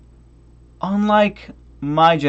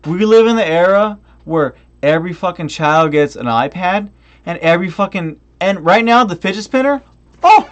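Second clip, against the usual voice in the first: normal talking voice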